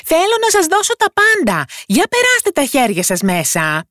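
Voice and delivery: very high little yell; high-pitched, all-out call; shouting full force. A narrator's reading. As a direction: cry out with all your tiny might. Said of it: "φώναξε για ακόμα μία φορά με όλη του τη δύναμη".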